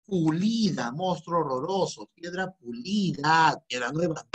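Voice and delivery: slow teacherly emphasis on piedra pulida; drawn-out correct term; slow dictation. Shown as a demonstration. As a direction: spell it out slowly, word by word. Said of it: "Vas a decir esto".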